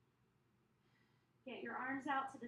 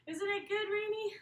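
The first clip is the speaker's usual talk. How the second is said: high and loud, cheerful aside; bright burst of praise to a pet; excited; 8 semitones higher, 8 dB louder than usual. Real, not acted. Excited aside to the dog mid-workout.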